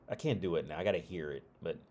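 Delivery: ordinary delivery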